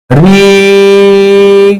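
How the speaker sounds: री held out long and steady; long drawn री. Shown as a demonstration. Teacher shows री stays री however long. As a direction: hold the री as long as you can. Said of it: "री चाहे जितना लंबा बोले".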